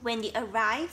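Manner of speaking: plain reading voice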